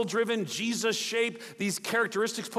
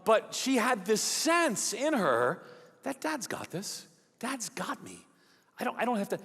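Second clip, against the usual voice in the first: ordinary talk, nothing marked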